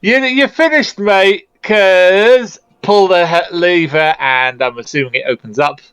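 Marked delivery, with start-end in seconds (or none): 0.0-4.1 higher-pitched yell without real volume; mock yell. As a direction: call down the hall in a half-hearted yell. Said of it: "and yell".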